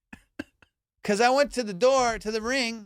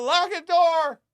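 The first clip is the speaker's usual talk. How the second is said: high mock shout; imitated yell; 10 semitones higher, 4 dB louder, slower than usual. Guy heard behind the lady, imitated high yell. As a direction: call it out urgently from the side, pitched way up. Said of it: "from the side".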